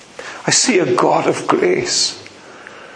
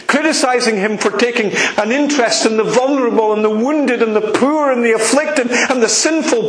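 ordinary talk, nothing marked

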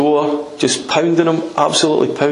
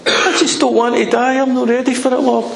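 high-pitched pleading prayer; acted, unpolished plea in a raised voice; simple heartfelt plea; 9 semitones higher than usual. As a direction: plead with God not to let you die. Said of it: "No great prayer of faith, just".

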